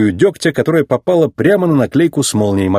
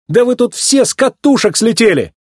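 high-pitched indignant outburst; indignant, disgusted; 10 semitones higher than usual. A narrator's reading. Racer protests his sentence to the townsfolk, indignant. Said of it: "возмутился гонщик, переполненный чувством отвращения".

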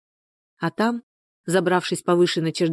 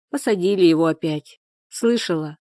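ordinary delivery, nothing marked

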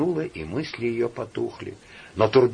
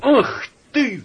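high furious cry; shouting; 7 dB louder than usual. Acted. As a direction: yell it out in a rage. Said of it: "вскричал во сне Турбин".

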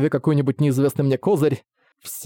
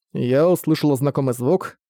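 usual speaking voice

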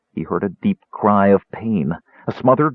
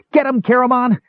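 shrill yell. A narrator's reading. Tass urges Caramon against the Draconians with a high, shrill yell. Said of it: "Tass yelled shrilly".

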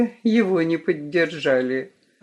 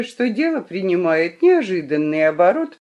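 ordinary delivery, nothing marked

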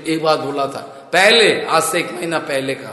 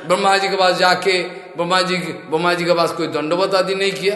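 ordinary talk, nothing marked

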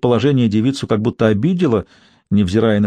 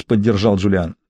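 plain reading voice

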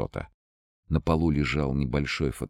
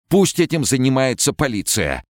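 much higher, louder panicked cry; frightened shout; 12 semitones higher, 6 dB louder than usual. A narrator's reading. Перепуганный директор продолжает кричать. (испуганный крик)

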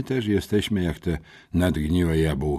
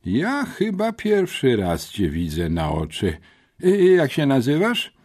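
headmistress; 6 semitones higher, 3 dB louder than usual. A narrator's reading. Headmistress asking.

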